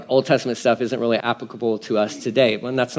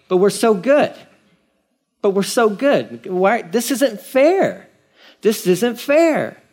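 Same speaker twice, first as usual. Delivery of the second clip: high, slow, whining complaint; mock-whine like a sulking child; whiny protest; 7 semitones higher, slower than usual. Israelites crying out to God that it isn't fair, whining high and slow.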